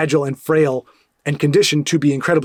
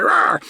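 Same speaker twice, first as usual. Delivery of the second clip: sudden high hammed-up rah; playful fake battle cry; mock angry roar; 10 semitones higher than usual. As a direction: roar it like a cartoon tough guy.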